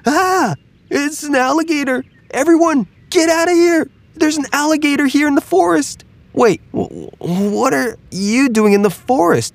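very high, shrieking squirrel alarm; a squirrel warning the whole forest; panicked squirrel. Acted.

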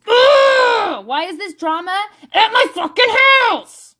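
high, loud groan into a fed-up rant; exasperated outburst. Retold or imitated. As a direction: vent like the drama just landed on you. Retold exasperation at the drama.

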